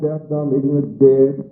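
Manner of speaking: usual speaking voice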